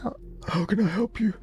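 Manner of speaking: put-on high, chirpy serving-girl voice; high barkeeper voice. Barmaid greeting a customer, high chirpy voice.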